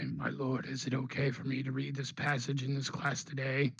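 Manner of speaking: bow to the dark lord, mock reverent; lightly voiced devoted cultist. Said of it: "talk in the demon voice".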